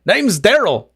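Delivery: high, loud, jolly dwarf introducing himself; a boisterous bard offering his hand; hearty dwarf. Acted.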